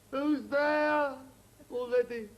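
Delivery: high, loud hail from afar; stranded skier calling. Acted. Stranded skier calling to the rescuers, loud high shout. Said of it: "he was crying for help".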